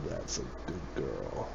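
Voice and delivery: deep, hushed, barely moving the mouth; dad voice. Pretend. Repeating it after "Wait, what?", dad voice. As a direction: murmur it very low like a teasing dad. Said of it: "like, not moving your mouth".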